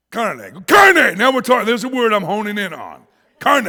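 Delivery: high, very loud triumphant cry; excited shout. Retold or imitated. American finally understood by waiter, excited shout. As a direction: pounce on the word at full volume. Seizes on the word somebody offered.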